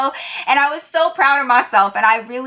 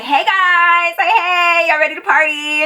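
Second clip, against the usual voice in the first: hype and peppy; 6 semitones higher than usual. Acted. Waitress greets a bachelor party in VIP, hyped and peppy. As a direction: chirp it like a peppy hostess. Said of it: "real hype and real peppy".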